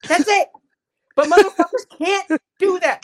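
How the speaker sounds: high, emphatic, exasperated rant; worked-up anger. Real, not acted. Co-host lecturing people who won't change their minds, mad. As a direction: lay it out hotly, pushing the key words. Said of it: "I'm getting so mad".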